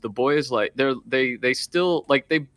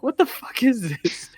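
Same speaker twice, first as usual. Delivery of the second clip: mock bafflement; 9 semitones higher than usual. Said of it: "they were like".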